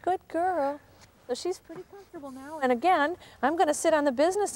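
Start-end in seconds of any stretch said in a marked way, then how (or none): 0.0-0.8 high-pitched praise. Real, not acted. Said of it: "my voice is raised in pitch".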